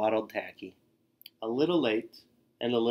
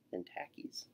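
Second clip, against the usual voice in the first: soft unvoiced whisper; 5 semitones lower, 17 dB quieter than usual. A narrator's reading. Narrator whispering how nervous Tacky's team is. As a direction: drop to a whisper.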